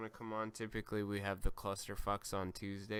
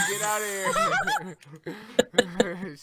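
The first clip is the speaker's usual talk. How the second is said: high laughing yell; laughing shout; 10 semitones higher, 10 dB louder than usual. Real, not acted. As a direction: shriek it out through laughter. Laughing outburst at friend.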